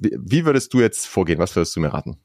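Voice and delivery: normal talking voice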